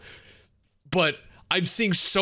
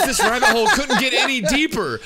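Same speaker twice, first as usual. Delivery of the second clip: high giggly disbelief, louder; laughing; 9 semitones higher, 6 dB louder than usual. Real, not acted. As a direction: laugh through the words. Laughing disbelief at confusing lore.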